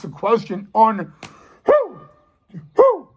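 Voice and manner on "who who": very high, owl-like hoot; playful hoot. Pretend. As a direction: pop it up high like a hooting owl. Hoots a teasing question word.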